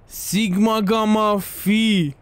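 very slow, drawn-out reading; mock slow reading. Streamer reading Ashley's phone charm, slow and mocking. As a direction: drag out each syllable mockingly.

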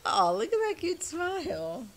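cooing. Real, not acted. Cooing at the puppy.

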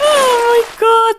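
high, stretched squeal of amusement; delighted laughter. Real, not acted. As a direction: squeal it while cracking up. Delighted by the splashing baby.

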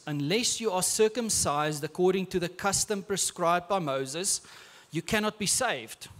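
ordinary talk

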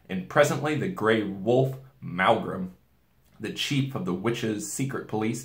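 plain reading voice

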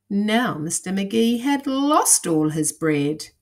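animated storytelling with punched key words; lively picture-book narration; dramatic children's story reading. Acted. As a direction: build the drama for young listeners.

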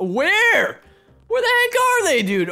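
loud, high, incredulous yelp; baffled outburst. Real, not acted. Gamer who can't find the last guy, baffled outburst.

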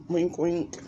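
ordinary delivery

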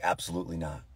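low, soft deadpan; straight-faced stoicism. Retold or imitated. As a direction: imitate a stoic child refusing to cry. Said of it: "straight face".

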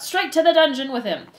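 loud, high demand from the crowd; crying out. Acted. Crowd member condemns Despereaux, crying out. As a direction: shout for his sentence. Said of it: "a voice cried out".

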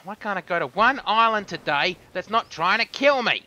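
loud, high-pitched angry cursing; exasperated outburst. Real, not acted. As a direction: explode in real frustration at the game. Cursing at being shot in game.

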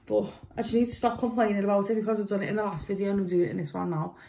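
usual speaking voice

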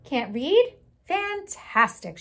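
a teacher thrilled to find a new pupil; delighted bird. Acted. Bird delighted that Rocket cannot read, high excited lilt. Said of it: "she waved with a wing".

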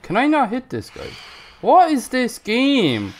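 loud, high-pitched exasperated rant; frustrated rant. Real, not acted. Player who can't hit this guy, frustrated rant. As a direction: yell your complaint at the game.

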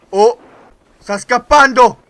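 ordinary talk

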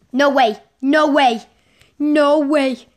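loud, thrilled disbelief; excited disbelief. Real, not acted. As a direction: shout it out in delighted shock. The neon pet appears.

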